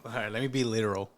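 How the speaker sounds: ordinary delivery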